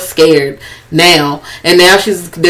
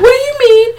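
very high squeaky mimicked protest; mock upset. Voicing the scared woman accusing her friends, high mock upset. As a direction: act out an indignant protest, pitched way up.

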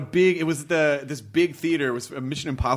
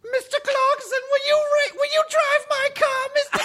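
put-on much higher kid's taunting voice; heckling kid. Retelling kids heckling Clarkson in a cinema, high mocking voice. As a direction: taunt like a snotty thirteen-year-old. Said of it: "it was just a lot of".

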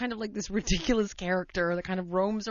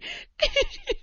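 real laughter. Real, not acted. Laughing continues.

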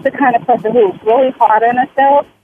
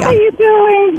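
excited; 10 semitones higher than usual. Real, not acted. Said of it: "I, I'm excited".

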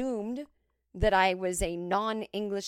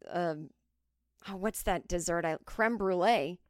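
normal talking voice

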